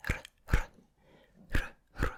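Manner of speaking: R trilled on the tongue; short, separate trilled R sounds; rolled R. Shown as a demonstration. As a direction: roll each R sharply, Glaswegian style. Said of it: "we roll our R's a lot".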